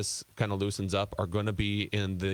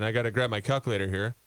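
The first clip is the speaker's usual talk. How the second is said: usual speaking voice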